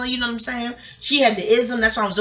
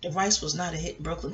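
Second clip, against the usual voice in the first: lower, softer title read; chapter title reading; 5 semitones lower, 10 dB quieter than usual. Shown as a demonstration. Storyteller trying out a chapter title, lower and hushed. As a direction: intone it quietly as a book's chapter title. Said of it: "Don't that sound like it leads a ti-, a chapter?".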